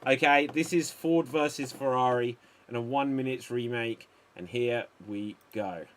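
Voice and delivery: ordinary delivery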